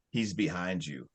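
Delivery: low, hushed, ominous retelling; creepy. Retold or imitated. Retelling the possessed girl's words to Riley, creepy. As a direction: drop low and soft, eerily. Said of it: "it starts getting creepy".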